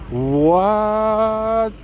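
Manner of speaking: high, drawn-out disbelief; gasping at the donation; stunned what. Real, not acted. Huge super chat appears.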